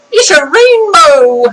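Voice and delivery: high, drawn-out Irish leprechaun-style cry; mock Irish voice. Vlogger imitating the rainbow's Irish character, mock Irish lilt. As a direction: put on a silly high Irish accent. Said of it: "I can't do the Irish voice".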